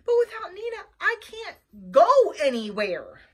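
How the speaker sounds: loud, high wail; yelled protest. A narrator's reading. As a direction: wail it helplessly at full voice. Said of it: "I yelled".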